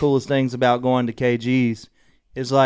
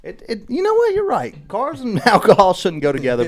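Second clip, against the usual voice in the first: high squeaky "you know what, you're right"; mock realization; 9 semitones higher, 5 dB louder than usual. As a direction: pretend to suddenly see the light. Mock sudden concession to friend.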